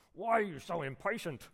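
acted high, impatient complaint; impatient parent. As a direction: scold a child in mock impatience. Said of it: "this is a common phrase for me".

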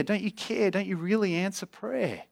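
high, insistent pleading questions; crying out to God. Acted. Preacher voices God's people doubting He answers, pleading. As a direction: press God with one plea after another. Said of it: "And we cry out to God".

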